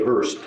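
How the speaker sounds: "Hearst" said with a clear English h and r; "Hearst" in plain English pronunciation. Shown as a demonstration. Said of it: "or as we say".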